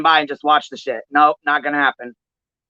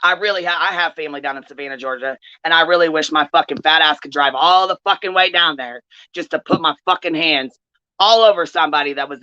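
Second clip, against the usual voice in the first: usual speaking voice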